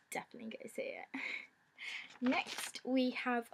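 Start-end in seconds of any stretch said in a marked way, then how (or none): 0.1-1.2 whispering. Real, not acted. Hushed low recommendation.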